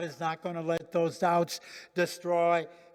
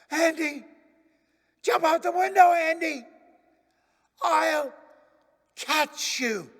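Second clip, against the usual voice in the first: loud, urgent shout up to the window; frantic dad yelling from below; father yelling up; 9 semitones higher, 6 dB louder than usual. Acted. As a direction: call up to your son to jump. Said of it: "his dad yelled from below".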